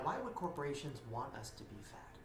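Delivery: ordinary talk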